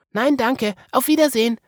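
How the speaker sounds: put-on high polite child voice; Marie's goodbye. A narrator's reading.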